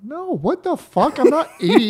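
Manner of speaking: high, laughing outrage; indignant surprise. Real, not acted. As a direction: laugh it off, offended. Offended at the Tootsie Rolls pick.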